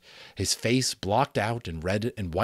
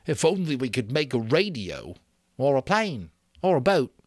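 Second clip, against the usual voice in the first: higher, rising longing; wishful Piggy; 6 semitones higher, 5 dB louder than usual. Acted. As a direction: let the wish grow eagerly. Piggy wishes for rescue.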